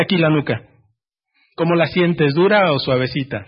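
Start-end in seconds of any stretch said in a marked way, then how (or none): none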